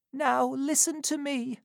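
put-on high, thin old crone voice; an old woman on her deathbed, urgent; dying old woman. A narrator's reading. Old Sally to Missus Corney, a high old crone voice.

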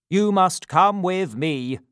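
loud, slow commanding official; pompous beadle. Acted. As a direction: order the boy about. Bumble orders Oliver.

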